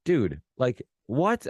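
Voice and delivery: high, stunned disbelief, acted out; retold freaking out. Fan recalling the first time he heard the song, retold amazement. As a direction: replay your amazement, pitch leaping up on the last word. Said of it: "freaking out".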